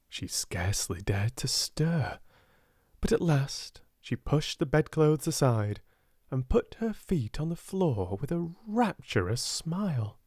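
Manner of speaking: ordinary delivery, nothing marked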